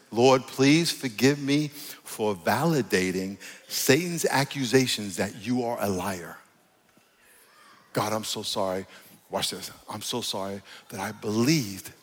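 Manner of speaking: soft, halting prayer of apology; repentance prayer. Shown as a demonstration. Preacher modelling repentance to God, soft contrite prayer. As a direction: pray it quietly, pausing between phrases.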